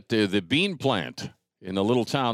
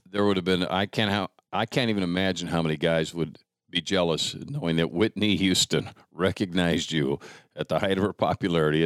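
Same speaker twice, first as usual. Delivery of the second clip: ordinary talk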